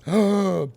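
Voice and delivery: put-on high gasp from the baby bird; exaggerated storybook gasp; acted sharp inhale. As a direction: suck in a big breath to get ready. Baby bird draws breath too.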